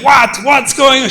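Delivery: high, loud alarmed call; alarmed wife yelling from the bedroom. Retold or imitated. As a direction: shout from the next room, startled.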